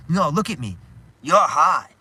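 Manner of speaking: imitated confrontation. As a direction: demand attention, then blast the accusation. Retold TV confrontation, shouted.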